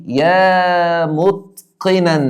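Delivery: يا متقنا stretched out very slowly; slow demo. Shown as a demonstration. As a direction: draw the call out word by word. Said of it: "هنا أقول له إيه". (نداء بطيء جدًا وممدود)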